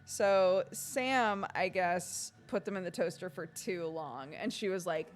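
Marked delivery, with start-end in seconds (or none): none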